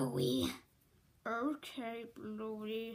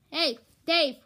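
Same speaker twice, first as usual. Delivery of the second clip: calling out; 3 semitones higher, 10 dB louder than usual. Real, not acted. Calling out to Dave.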